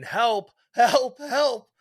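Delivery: imitated bird yelling help; parrot screaming help. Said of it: "he's screaming".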